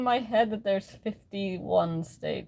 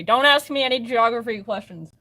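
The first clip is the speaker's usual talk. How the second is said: louder, higher, flustered; flustered protest; 4 semitones higher, 7 dB louder than usual. Real, not acted. Streamer admitting she can't do geography, flustered and loud. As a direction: blurt it out, embarrassed.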